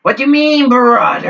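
high, loud meme whine; mock outraged yell. Repeating meme catchphrase.

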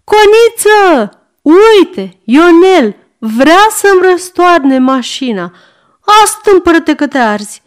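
put-on high, loud old woman calling from next room; old servant woman. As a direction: cry out like a fussing old maid. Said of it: "Dar vocea de dincolo adaugă".

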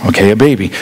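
low, underwhelmed acknowledgment; unimpressed reply. Acted. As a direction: drop low, as if missing the big deal. Unimpressed listener answers the angel.

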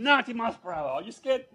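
high, loud taunting over the engine; excited taunt. Retold or imitated. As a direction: shout the speed and dare him. Driver at top speed, taunting.

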